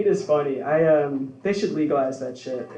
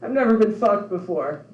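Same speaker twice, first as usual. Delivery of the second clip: mock sobbing woman; 5 semitones higher than usual. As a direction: play her as a crying woman. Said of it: "She's like".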